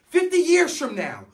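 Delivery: normal talking voice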